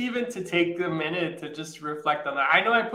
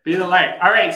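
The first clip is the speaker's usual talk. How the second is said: upbeat; 9 dB louder than usual. Real, not acted. Upbeat sign-off.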